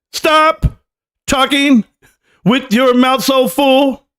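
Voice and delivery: high, slow, laughing yell; laughing shout. Real, not acted. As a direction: holler it through a laugh. Yelling at clip, laughing.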